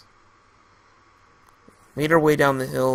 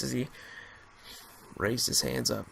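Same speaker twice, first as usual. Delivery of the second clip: plain reading voice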